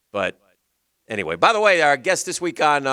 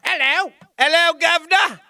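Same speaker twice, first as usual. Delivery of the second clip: much higher mock Cockney greeting; Cockney accent; 4 dB louder than usual. Shown as a demonstration. Host joking to cohost in a mock Cockney accent. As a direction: chirp it like a Cockney cabbie. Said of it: "not a Cockney accent either".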